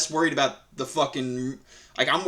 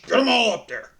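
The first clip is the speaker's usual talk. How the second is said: high, loud mock voice; sneering imitation of the squeamish dad; 8 semitones higher, 5 dB louder than usual. Mock voice of the diaper changer.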